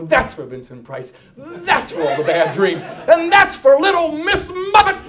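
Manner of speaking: building to a high, loud yell; vengeful outburst. Acted. Acts out bashing spider with shoe.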